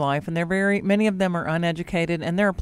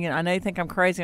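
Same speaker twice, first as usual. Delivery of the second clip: ordinary delivery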